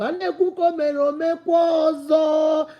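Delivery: loud, emphatic Igbo saying; shouted Igbo proverb with emphasis. Real, not acted.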